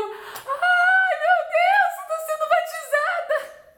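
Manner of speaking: thrilled shriek of joy melting into a laugh; ecstatic shout. Retold or imitated. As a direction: cry it out overjoyed, laughing as you finish. Retelling overjoyed moment of baptism.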